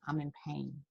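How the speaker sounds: soft, low imitation of a hurting child; subdued, lightly acted admission of pain; quietly pained. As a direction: drop the voice, letting slight hurt show. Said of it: "their tone of voice is saying".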